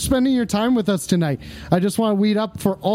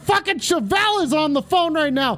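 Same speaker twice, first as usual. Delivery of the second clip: excited shout; 9 semitones higher than usual. Real, not acted. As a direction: yell it like a starstruck fan. Starstruck by the rock singer caller.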